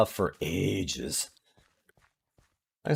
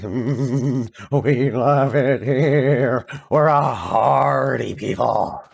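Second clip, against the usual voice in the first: slow, drawn-out hardy-folk voice; slow stoic Minnesotans; much slower than usual. Retold or imitated. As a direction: savour each word like a frozen local. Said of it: "They're just like".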